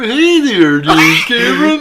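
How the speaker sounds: high, slow put-on voice; cartoon character voice. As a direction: squeak like a cartoon guy. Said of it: "He's like".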